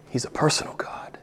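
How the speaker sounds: hushed, breathy whisper. Acted. Preacher whispering the point to his congregation. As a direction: lean in and whisper it.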